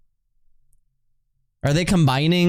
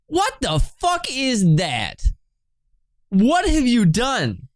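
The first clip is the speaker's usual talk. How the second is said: higher, slow disbelieving cry; appalled shock; 8 semitones higher than usual. Real, not acted. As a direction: draw out the horror slowly. Reacting to ugly Pokemon.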